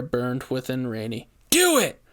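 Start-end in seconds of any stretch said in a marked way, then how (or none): 1.5-2.0 urgent shout. A narrator's reading. Rainey urging Daniel.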